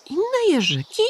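put-on high childlike wondering question; a small boy amazed; curious little hedgehog. A narrator's reading. Little Igiełka asking the pigeon, high child voice. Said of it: "Zapytał malec".

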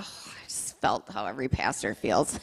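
hushed, toneless whisper. Real, not acted. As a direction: breathe it out like a secret confession. Confessing pastor's pain to audience.

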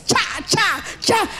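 quick scissor noises; imitated scissor chops. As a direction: chop chop chop sound. Scissors hacking sound.

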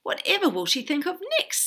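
quick, high, weary exclamation; exasperated sigh. A narrator's reading. Townsfolk fed up with Arabella, sighing. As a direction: toss it out in exasperation. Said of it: "sighed the townsfolk".

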